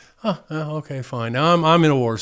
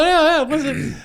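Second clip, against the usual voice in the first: much higher, lightly put-on sergeant voice; gruff sergeant; 14 semitones higher than usual. Sergeant answering the MP's call, mock gruff. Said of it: "he's kind of a gruff guy".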